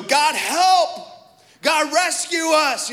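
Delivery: imagined battlefield shout to heaven; loud desperate plea. Acted. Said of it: "we know it was loud".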